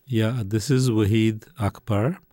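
ordinary talk, nothing marked

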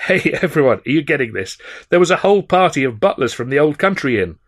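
laugh, then hail the whole table; delighted shout to the table; calling out. Acted.

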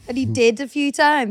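usual speaking voice

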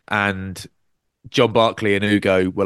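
ordinary talk

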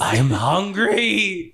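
high, very drawn-out monster whine; Octo. Acted. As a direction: stretch it out as a ravenous creature. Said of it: "you hear Octo go".

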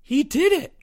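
very high, shocked gasp. A narrator's reading. Timmy reacts to the confession, gasping. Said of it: "gasped Timmy".

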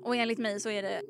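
ordinary delivery

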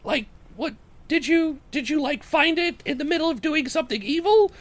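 very high-pitched halfling voice; questioning halfling Jorik. Acted. Halfling Jorik asks how they caught the creature, questioning. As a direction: probe for answers in a small, high voice.

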